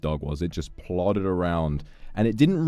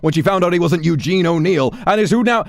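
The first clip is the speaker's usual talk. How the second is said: much higher, loud screaming tirade; furious scolding; 11 semitones higher, 10 dB louder than usual. Acted. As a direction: tear into them like a raging drill sergeant. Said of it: "You want to be scolded".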